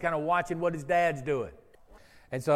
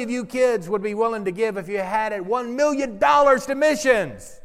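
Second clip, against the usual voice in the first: louder, higher, rousing shout; more enthusiastic; 5 semitones higher, 5 dB louder than usual. Shown as a demonstration. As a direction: fire up the kids with the question. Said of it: "let's be more enthusiastic".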